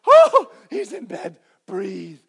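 relieved exhale. Retold or imitated. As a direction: sigh with relief that the toddler is asleep. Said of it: "So you're like".

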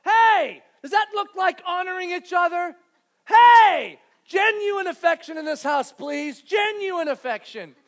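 put-on high, loud scolding shouts at the kids; yelling parent. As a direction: bark at the kids to behave. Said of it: "doing something like this".

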